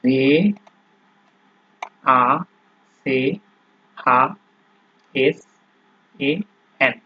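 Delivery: letter by letter, each letter clearly apart; spelling aloud. Shown as a demonstration. Teacher spelling ভাক্সেন aloud letter by letter. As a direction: spell ভাক্সেন out slowly for students. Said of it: "spelling the word letter by letter".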